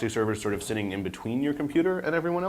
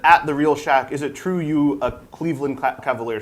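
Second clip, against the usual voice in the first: loud, raised-pitch dramatic tweet reading; hammed-up reading, easing off at the end; 5 semitones higher, 7 dB louder than usual. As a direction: read it out like a mock proclamation. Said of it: "could you do a dramatic reading here?".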